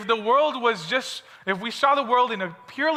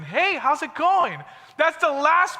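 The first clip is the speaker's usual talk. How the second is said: a bright, slow, higher mock-cheerful hello; cheery greeting; 6 semitones higher, slower than usual. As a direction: play the annoyingly upbeat friend, lightly. Teacher voices an overly positive person.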